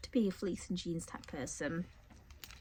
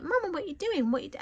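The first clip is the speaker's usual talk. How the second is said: high-pitched imitation of a nagging child; pestering little kid; 10 semitones higher, faster than usual. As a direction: mimic a child demanding mum's attention. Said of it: "Those of you with little kids".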